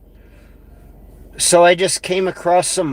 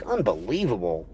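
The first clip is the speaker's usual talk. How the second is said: usual speaking voice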